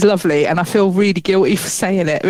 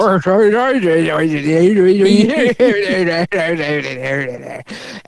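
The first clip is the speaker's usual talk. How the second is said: put-on garbled laughing mumble of a thick accent; mock Cornish mumble. Mocking the husband's thick accent with garbled laughing mumble. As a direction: mumble incomprehensibly through laughter. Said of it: "All, all I hear is".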